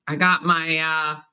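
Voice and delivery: ordinary delivery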